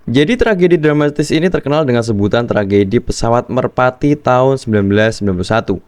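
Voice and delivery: ordinary talk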